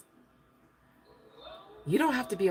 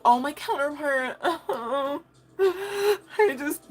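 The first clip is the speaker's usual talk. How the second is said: higher, whimpering mock lament with a laugh; a hammed-up sob over the counterpart; mock whiny self-pity; 9 semitones higher, 3 dB louder, slower than usual. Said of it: "If you".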